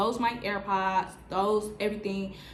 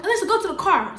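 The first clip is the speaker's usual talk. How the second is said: calling out; 9 semitones higher, 7 dB louder, faster than usual. Retold or imitated. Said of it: "So I'm like".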